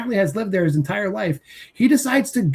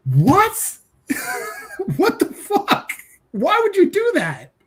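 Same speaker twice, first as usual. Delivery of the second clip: high, incredulous outburst; can't believe what the character just did; stunned disbelief; 10 semitones higher, slower than usual. Real, not acted. Reviewer reacts to the hero walking into the witch's house, incredulous and high. Outraged reaction to movie ending.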